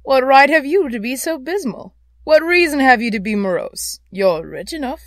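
loud, high, playful retort; cheerful teasing comeback. A narrator's reading. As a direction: toss the challenge back merrily. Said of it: "returned the nephew gaily".

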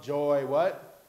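ordinary talk

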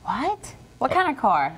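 high, sharp startled What?; surprised. Real, not acted. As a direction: gasp it out in disbelief. Reacts to car giveaway.

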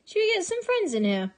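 imitating friends. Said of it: "all my friends are just like".

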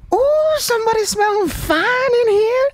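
impression of the lady. Imitating the woman on the train, high and slow. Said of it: "she was like".

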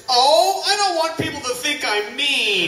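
mock wife. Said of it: "and Amanda said".

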